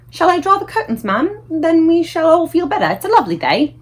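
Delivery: high, bright-voiced maid; cheerful maid's offer. A narrator's reading. Maid to the Queen, offering to open the curtains.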